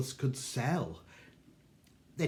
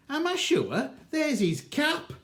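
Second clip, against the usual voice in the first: father's much higher indignant retort; 13 semitones higher than usual. Acted. Father answers the keeper, high indignant disbelief. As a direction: squeak up in outrage, pointing at the cap.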